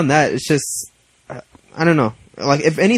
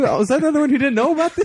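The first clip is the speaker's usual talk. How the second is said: high and fast, laughing through it; 13 semitones higher, faster than usual. Real, not acted. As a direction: laugh while asking. Laughs at being left out.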